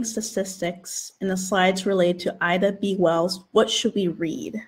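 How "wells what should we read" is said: ordinary delivery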